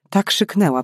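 ordinary delivery, nothing marked

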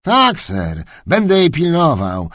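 high-voiced, obedient servant; servile. Kennelman promising the duke to watch the dog, servile. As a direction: answer the master obsequiously. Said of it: "Heinz odparł służalczo".

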